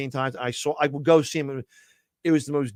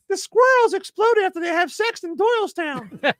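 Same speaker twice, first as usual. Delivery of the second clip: much higher cute dummy voice; squeaky puppet voice; 3 dB louder than usual. Acted. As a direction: chirp it like a tiny cute puppet. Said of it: "Mister McGonagall".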